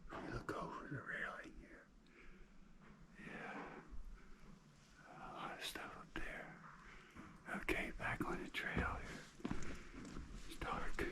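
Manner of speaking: hushed cave whisper. Real, not acted. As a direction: keep it to a whisper, in awe. Whispering through the cave tour.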